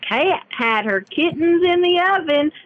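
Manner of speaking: slow drawn-out Southern twang; folksy country drawl, stretched. Acted. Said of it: "So we're gonna say".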